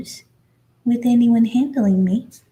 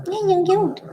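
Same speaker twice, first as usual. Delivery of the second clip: high coo; 9 semitones higher than usual. Real, not acted. Cooing to puppy.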